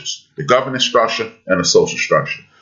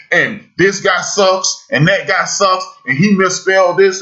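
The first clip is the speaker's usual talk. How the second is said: higher-pitched mock critic running others down; mock put-down; 8 semitones higher than usual. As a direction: list faults in a raised, critical voice. Acting out someone putting others down.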